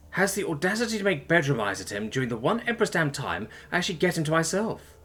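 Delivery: high-pitched indignant grumbling; jealous rant. A narrator's reading. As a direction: rant inwardly about a rival, pitch climbing. Jealous drunken inner rant at rival.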